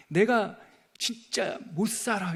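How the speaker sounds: Jonah throwing a tantrum at God; mock exasperation. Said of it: "그 더 화를 내요". (짜증 섞인 과장된 하소연)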